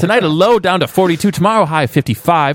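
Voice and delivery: cheesy radio forecast read. Pretend. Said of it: "mashup of radio voices".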